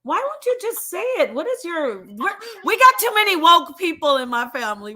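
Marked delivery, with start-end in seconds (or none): 0.0-3.3 exasperated plea. Real, not acted. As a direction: pitch jumps way up, pleading with exasperation. Pressing sister on the phone.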